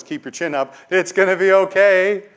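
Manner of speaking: chirpy false comfort, as if patting his back; forced cheerfulness. Retold or imitated. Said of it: "That wasn't faith".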